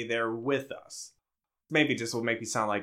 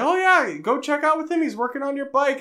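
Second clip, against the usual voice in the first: high, bright mock dad; cheery dad voice; 13 semitones higher, 3 dB louder than usual. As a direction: chirp it like an eager dad. Imitating dad's cheery suggestion.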